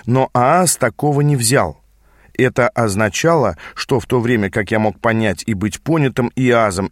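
ordinary delivery

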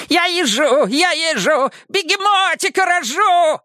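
high manic shouting; crazy patient voice. Acted. Mad patient shouts nonsense.

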